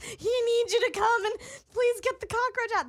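high, loud mock plea; begging the hotline in a put-on voice; pleading. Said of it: "I'm like".

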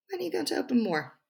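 high-pitched mock questioner; mimicked nosy question. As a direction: parody the question everyone always asks. Mimics the question everyone always asks.

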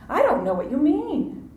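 high-pitched playing dumb; feigned ignorance. Peter denies knowing Jesus to the servant girl, feigning ignorance. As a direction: act clueless, pitching the denial up high. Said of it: "Vain ignorance".

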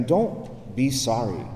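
hushed, unhurried comfort; gentle reassurance. Retold or imitated. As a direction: gently reassure someone who is crying. Priest consoling a crying visitor.

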